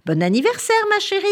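high, lilting celebration; joyful birthday greeting. A narrator's reading. Father greeting Anna on her birthday, overjoyed. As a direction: sing out the wish, rising on the endearment.

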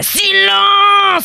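high, long-drawn royal shout; furious cry. Acted. Enraged king silencing the assembled swarms, furious cry. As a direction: shout it out, stretching the vowel. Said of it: "Il s'écria".

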